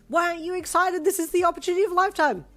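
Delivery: high and loud, fast at the end; excited. A narrator's reading. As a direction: push him to be excited. Girlfriend urging him.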